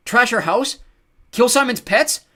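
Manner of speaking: loud, high outrage; mounting indignant yell; angry accusation. A narrator's reading. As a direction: shout each charge as a question. Jared's accusations grow angrier.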